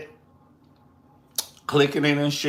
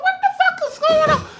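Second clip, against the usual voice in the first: a shocked shriek with a laugh in it; shrieking disbelief; 7 dB louder than usual. Real, not acted. Watching Mercedes dance, a high laughing shriek. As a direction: squeal it out high and loud, half laughing.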